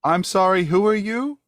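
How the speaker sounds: townswoman. Acted. Woman questions an intruder in her house, high rising voice.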